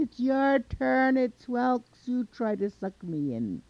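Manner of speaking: high, slow holler that eases at the end; long-drawn, high carrying yell. A narrator's reading. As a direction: taunt him loudly from above. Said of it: "yells down at him".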